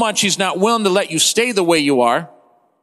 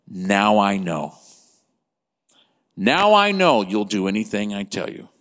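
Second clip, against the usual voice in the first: voice of the Lord; 9 semitones lower than usual. Retold or imitated. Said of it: "what the Lord says".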